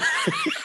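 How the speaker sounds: short, high-pitched laugh. Real, not acted. Cracking up at his own story for the host, high. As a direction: squeal with laughter.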